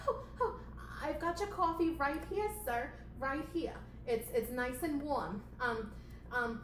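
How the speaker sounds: high, anxious puppet assistant; timid servile little voice; flustered assistant. Acted. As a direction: rush in high and eager to please. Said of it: "playing the assistant character".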